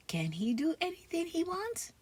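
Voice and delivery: ordinary delivery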